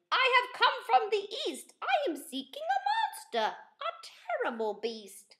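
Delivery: a keen little knight announcing himself; bright, bold young knight voice; eager young knight. Acted. Kevin speaks to old man.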